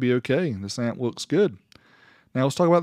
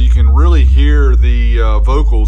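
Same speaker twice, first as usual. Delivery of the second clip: loud, raised voice over booming bass; talking over loud music; 15 dB louder than usual. Real, not acted. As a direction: raise your voice above the bass so they hear you.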